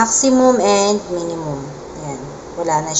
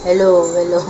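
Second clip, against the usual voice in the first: illumination voice effect; 3 semitones lower than usual. Shown as a demonstration. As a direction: voice through an eerie processing filter. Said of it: "And then, illumination". (nakakatakot na boses sa effect)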